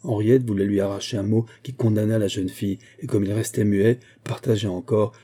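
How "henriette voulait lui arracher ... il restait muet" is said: plain reading voice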